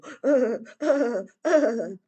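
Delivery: bursts out laughing, high and loud; real laugh. Real, not acted. Laughing at herself after telling the snake story. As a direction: let a real laugh break through at the memory. Laughs at her own panic.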